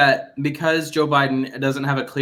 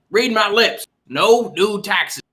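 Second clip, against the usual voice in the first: higher-pitched presidential impression, drawn out at the end; George H W Bush; 4 semitones higher, much slower than usual. Shown as a demonstration. Showing cohosts which presidents can be imitated, presidential impression. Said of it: "you do a George W, H W".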